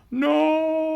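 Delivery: high, loud anguished no; Galvatron's scream. Pretend. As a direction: scream it like a beaten villain. Villain's defeat cry.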